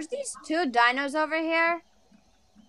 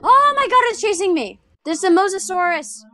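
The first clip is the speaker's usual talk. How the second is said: panicked, higher and louder; being chased; 4 semitones higher, 5 dB louder than usual. Real, not acted. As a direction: yelp as a sea monster chases you. Dino chasing her in game.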